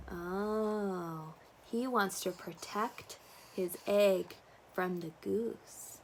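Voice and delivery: ordinary delivery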